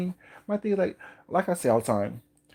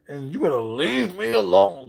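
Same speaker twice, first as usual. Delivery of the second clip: slow, high and louder, words pushed through gritted teeth; 6 semitones higher, 5 dB louder, slower than usual. Retold or imitated. Dee warning him through gritted teeth, loud and slow. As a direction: mimic a woman threatening through gritted teeth. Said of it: "gritting her teeth".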